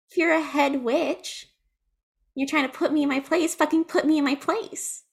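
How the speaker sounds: defiant challenge. Acted. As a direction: dare the head witch. Acted challenge to the head witch.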